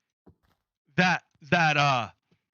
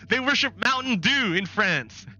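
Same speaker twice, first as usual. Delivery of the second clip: high-pitched mock-scandalized pundit; Newsmax; 6 semitones higher than usual. News channel revealing the French worship soda, mock alarm. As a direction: deliver the silly revelation higher, breaking up afterward. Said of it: "Newsmax would be like".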